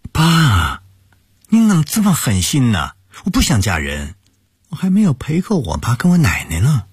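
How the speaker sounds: high-pitched pouting protest; mock displeasure. A narrator's reading. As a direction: whine at dad, pretending to be upset. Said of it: "佯装不快地说着".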